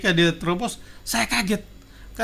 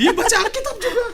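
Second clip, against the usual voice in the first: quoting her higher, breaking into laughter; laughing; 3 dB louder than usual. Retold or imitated. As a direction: giggle through the quote. (mengutip sambil tertawa)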